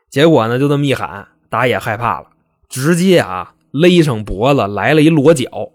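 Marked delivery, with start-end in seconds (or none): none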